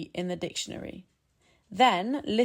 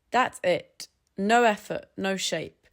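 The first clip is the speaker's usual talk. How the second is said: ordinary talk, nothing marked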